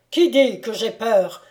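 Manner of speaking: loud, affronted challenge; indignant cry. A narrator's reading. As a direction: cry out as if stung. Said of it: "s'écria Maximilien en se redressant tout à coup".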